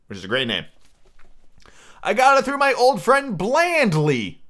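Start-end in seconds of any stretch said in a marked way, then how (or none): 2.0-4.4 high, theatrical squire voice; excitable letter-writing squire. Acted. Squire writing to his doctor friend about the schooner, excitable. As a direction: read the letter out grandly, swelling on key names.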